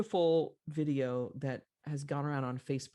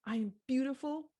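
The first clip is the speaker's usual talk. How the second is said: put-on high toddler voice, rising at the end; little girl; 10 semitones higher than usual. Little girl repeating after her mother, high childlike voice. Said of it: "the little girl says".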